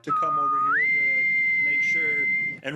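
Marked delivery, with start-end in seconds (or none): 0.0-1.2 high loud whistle call. Acted. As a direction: whistle for the elves. Whistling to the elves.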